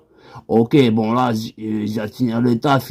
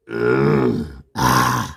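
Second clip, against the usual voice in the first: deep low hum then ah; low sustained warm-up tone; vocal warm-up; 11 semitones lower than usual. Shown as a demonstration. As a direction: drop to a very low held note. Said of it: "je me chauffe la voix".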